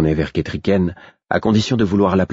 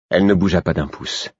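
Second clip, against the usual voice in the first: plain reading voice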